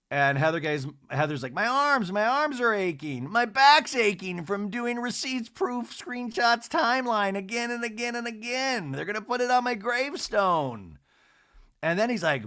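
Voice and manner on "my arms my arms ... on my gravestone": high, louder whining, stretching the words; hammed-up complaining housewife; whiny complaint. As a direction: moan about aching arms like a martyr. Quoting Heather whining about aching arms.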